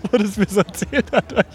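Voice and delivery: high, slow words squeezed through laughter; laughing while talking. Real, not acted. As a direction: struggle to talk while cracking up. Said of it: "auf den Arsch am Lachen".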